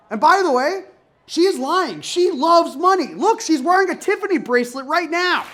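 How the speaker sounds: loud, high, worked-up accusation; mock indignant rant. Comedian exposing a cousin who claims communism, mock outrage.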